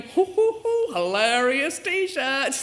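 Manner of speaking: high hoots, then a slow, drawn-out gloat; smug fake laughter, then a slow drawl; mock self-amused hooting. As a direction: ham up laughing at your own naff joke. Mocking herself laughing at own joke.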